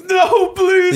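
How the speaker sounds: high, strained plea in a put-on American voice; pleading Hans Gruber. Villain faking fear to fool Bruce Willis, high plea. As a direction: beg for your life in a faked accent. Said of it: "He, when he goes".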